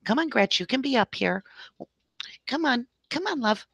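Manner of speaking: sweet-talking a puppy onto your lap; coaxing the puppy. Real, not acted.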